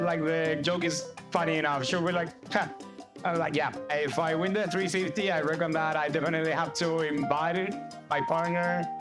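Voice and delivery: ordinary talk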